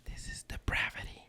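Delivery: hushed mock-shocked whisper. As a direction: whisper it like a scandal. Shocked aside about the show.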